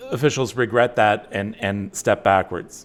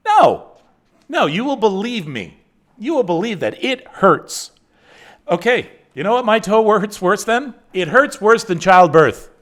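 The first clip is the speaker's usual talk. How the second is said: hammed-up, louder whine about the toe; mock agony; 8 semitones higher, 6 dB louder than usual. Host pretends his toe hurts worse than childbirth, mock agony. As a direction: overplay the toe pain to the viewers for a laugh. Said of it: "I am not saying that seriously".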